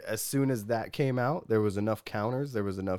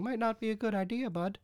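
high, wheedling mock plea; groveling politician; 12 semitones higher than usual. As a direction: simper it like a nervous flatterer. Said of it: "groveling at his feet going".

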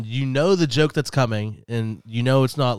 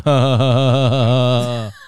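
loud, deliberately bad ha ha; stupid fake laugh; 6 dB louder, slower than usual. Shown as a demonstration. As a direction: spell out a dumb forced laugh. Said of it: "you can't just fake it to, to be stupid".

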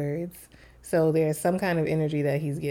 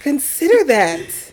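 loud, high, slow disbelief; exasperated parent; 9 semitones higher, 9 dB louder, slower than usual. Acted. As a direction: hammer it out, utterly baffled. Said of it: "every parent of boys has that feeling like".

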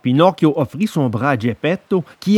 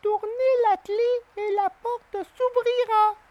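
tiny, very high and soft; a hidden little creature asks; a small voice from inside, high and soft; 9 dB quieter than usual. A narrator's reading. The cricket answers.

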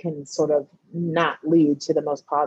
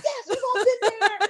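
knowing group chorus. Retold or imitated. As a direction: answer as the whole group at once, cracking up. Friends answer in knowing chorus.

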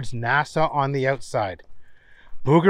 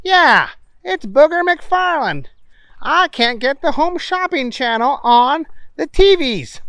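a bit higher character voice; Booger voice; 11 semitones higher than usual. Acted. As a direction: raise the voice for Booger.

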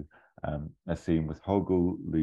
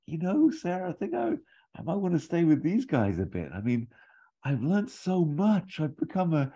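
Toby voice; 12 semitones higher than usual. Acted. Toby telling Sarah he wants to stay, high and earnest. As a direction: go up high and earnest like a smitten kid. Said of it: "And Toby's like".

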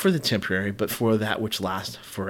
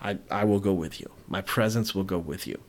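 softer and lower, a quiet reassuring yes; God giving in calmly to Moses; lowered, hushed promise of presence; gentle God; 3 semitones lower, 6 dB quieter than usual. Retold or imitated. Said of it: "And so God says".

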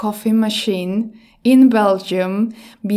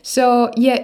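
normal talking voice

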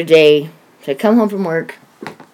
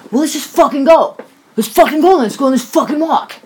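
son's angry outburst retold, higher but held back; toned-down imitated yelling; 7 semitones higher than usual. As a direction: act out his impatient yell without fully yelling. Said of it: "Only I'm not yelling like he was".

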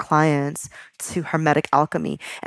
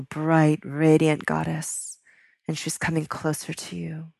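guided visualization. Acted. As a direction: gently lead them into the image of the goddess.

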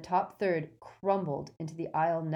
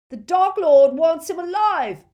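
frantic high, loud yell; shout; 12 semitones higher, 14 dB louder than usual. A narrator's reading. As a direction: yell a warning across a huge room. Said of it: "Malfoy shouted at Crabbe".

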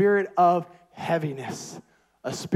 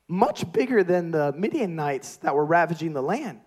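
ordinary talk

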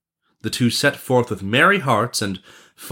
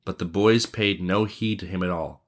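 usual speaking voice

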